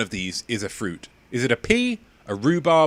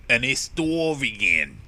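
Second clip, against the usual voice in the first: slow, drawn-out New York drawl; New York accent; slower than usual. Shown as a demonstration. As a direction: put on a heavy New Yorker accent, H dropped. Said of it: "New York".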